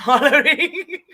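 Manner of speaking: word breaking into laughter; real amused laugh on the word. Real, not acted.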